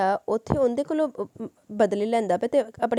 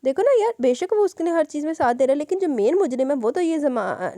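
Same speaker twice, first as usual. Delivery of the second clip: excited aside; 6 semitones higher, 3 dB louder than usual. Real, not acted. Reader's own aside, excited.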